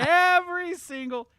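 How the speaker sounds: high, drawn-out yell on each word; emphatic shout. Real, not acted. Streamer insisting all developer teams know this, emphatic shout.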